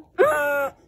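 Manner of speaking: sudden loud wordless outburst; loud gasp. Real, not acted. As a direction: gasp out loud at full force. Sudden loud outburst without words.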